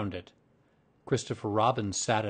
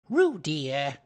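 higher, louder motherly reproof; reproachful; 5 semitones higher, 5 dB louder than usual. A narrator's reading. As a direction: chide a little child by name. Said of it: "said Kanga reproachfully".

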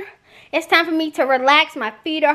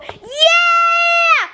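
loud, high cheer of yeah; excited shout; 13 semitones higher, 4 dB louder than usual. Real, not acted. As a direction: whoop at the end of the video. Hyped outro cheer.

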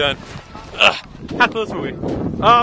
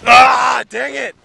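frustrated shout; 5 semitones higher, 8 dB louder, much slower than usual. Real, not acted. Losing the race.

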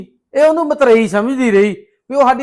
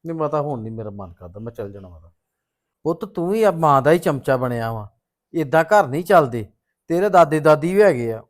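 lightly voiced father; 7 semitones lower, 5 dB quieter than usual. Same actor voices the father.

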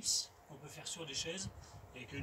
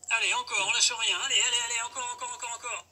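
loud, high hype shouting; gym bro; 6 semitones higher, 10 dB louder than usual. Acted. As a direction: push them hard through the final reps. Coach cheering on the last reps.

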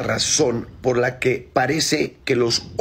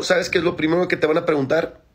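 ordinary delivery

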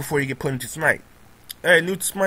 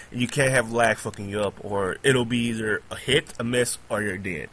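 ordinary delivery

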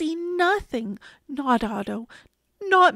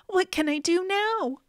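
higher-pitched worried question; at a loss; 7 semitones higher than usual. A narrator's reading. Boy with a growing fish, at a loss. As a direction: ask it helplessly, voice lifted.

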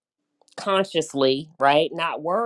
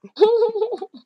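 high loud laugh; 8 dB louder than usual. Real, not acted.

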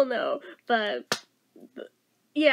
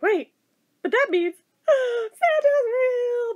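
amazed discovery; 9 semitones higher, slower than usual. Retold or imitated. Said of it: "they're like".

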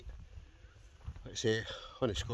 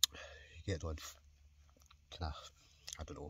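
whispering; 3 semitones lower, 11 dB quieter than usual. Real, not acted. Hushed aside about passers-by.

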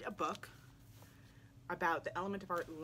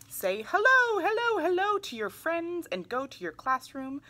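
much higher and louder, bubbling hello; excited greeting; 9 semitones higher, 9 dB louder than usual. Pretend. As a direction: call hello out to the whole class. Greeting friends in the book, excitedly.

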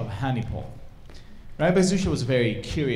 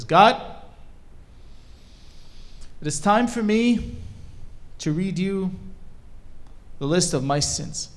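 loud, higher declaration settling toward the end; solemn address to God; 6 semitones higher, 3 dB louder than usual. Acted. Humble shoemaker opening his confession to God, solemn and loud. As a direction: call up to God, phrase by phrase with long pauses.

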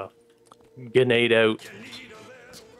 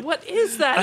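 surprised; 13 semitones higher than usual. Retold or imitated. Confused, seeing grenade overhead.

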